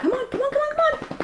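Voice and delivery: very high squeaky pet call; coaxing the dog. Real, not acted. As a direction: coax a shy dog in a tiny voice.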